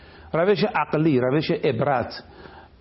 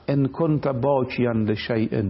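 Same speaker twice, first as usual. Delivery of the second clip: slow recitation; 4 semitones lower, slower than usual. Acted. Reciting Arabic hadith slowly.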